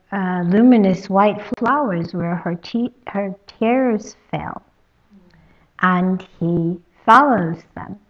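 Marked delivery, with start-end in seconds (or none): none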